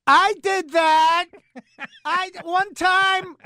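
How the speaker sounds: high, slow, drawn-out mock voice; mock storyteller voice. Woman launching into her long story, mock high drawl. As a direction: stretch it out high like an eager oversharer.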